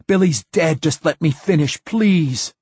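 high, loud begging cry; desperate pleading scream. A narrator's reading. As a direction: beg for time to finish. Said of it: "screamed the poet".

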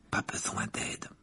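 soft, breathy aside; murmur. A narrator's reading. Ron muttering a joke to friends, murmured. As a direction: mutter it slowly under your breath. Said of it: "murmura Ron".

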